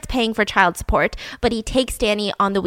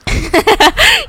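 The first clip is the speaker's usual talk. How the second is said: high, loud burst of laughter; 12 semitones higher, 7 dB louder than usual. Real, not acted. Reacting to a joke.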